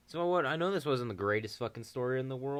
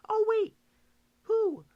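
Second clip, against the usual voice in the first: mock alarmed girl; 11 dB louder, slower than usual.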